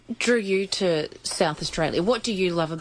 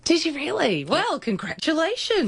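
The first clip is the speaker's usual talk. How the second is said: delighted surprise; 10 semitones higher than usual. Real, not acted. Host reacts to house purchase news.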